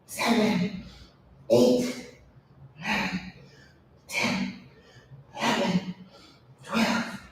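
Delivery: soft, breathy rep count; counting under breath. Real, not acted. Trainer counting her reps softly during the exercise. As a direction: count the reps quietly while working.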